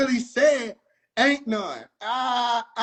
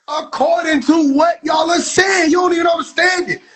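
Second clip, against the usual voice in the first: ranting shout; 7 semitones higher, 8 dB louder than usual. Real, not acted. Comic yelling at the room about the asteroid talk. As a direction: shout it down at them like you can't believe it.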